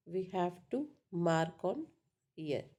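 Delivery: usual speaking voice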